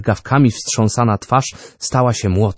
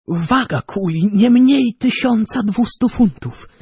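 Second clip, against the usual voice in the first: higher, proud, rising to a peak on mur; excited inventor; 9 semitones higher than usual. A narrator's reading. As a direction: show off his plans with mounting zeal. Narrator voices Orbano.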